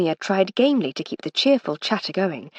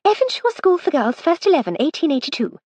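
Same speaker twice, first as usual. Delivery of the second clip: a touch of pride, higher; proud; 10 semitones higher, 4 dB louder than usual. A narrator's reading. Old cricketer presenting her team photograph, lightly proud. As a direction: announce it like a cherished honour. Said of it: "said Miss Titmuss proudly".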